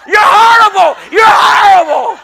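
loud, high joking yell; mock shout. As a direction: bellow the insult in jest. Joking insult to pastor, shouted.

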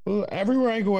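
normal talking voice

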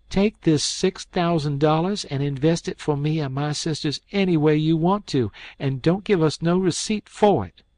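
high, resolute heroine; defiant young woman. A narrator's reading. Mary Jane hands over money.

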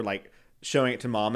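ordinary talk

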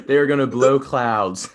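bursting into laughter through the words; laughing. Real, not acted. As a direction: laugh while you get the line out. Laughing at joke.

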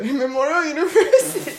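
laughing through the words. Real, not acted. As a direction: break into laughter mid-phrase. Laughing at the list.

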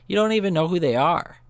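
mock outrage, voice jumping up; indignant outburst. Lady's retort quoted, rising in anger.

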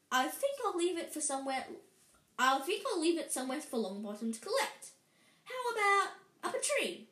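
gloating bully, quick and rising high at the end; nasty taunting. A narrator's reading. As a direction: dangle the threat with mock innocence. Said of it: "Malfoy smiled nastily".

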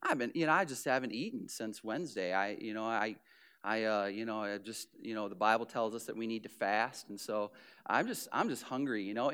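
low, soft, mock-humble mumble; humble-brag. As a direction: play down the fast while showing it off. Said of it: "you'll say".

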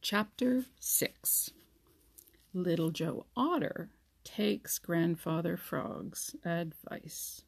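whispered, slow chapter title; chapter heading. A narrator's reading. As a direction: breathe the title out softly.

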